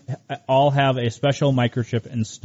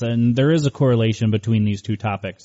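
ordinary talk

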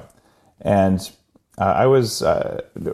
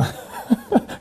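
high laugh; 5 dB quieter than usual. Real, not acted. Amazed laugh at own story.

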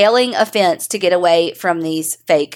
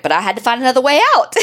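quoting daughter; 4 semitones higher, much faster than usual. Retold or imitated. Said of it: "She was like".

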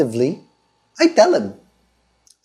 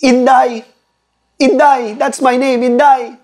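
trembling and shaking; 7 semitones higher than usual. Acted. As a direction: play a terrified wife blurting her name. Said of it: "trembling and shaking".

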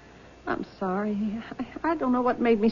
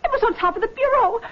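panicked; 7 semitones higher than usual. Acted. Daughter finds the ring missing.

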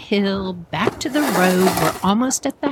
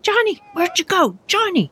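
high, worried call; calling out searchingly; 11 semitones higher than usual. Acted. Searching for the brother who vanished round the corner, high call. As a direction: call into the distance, pitch way up.

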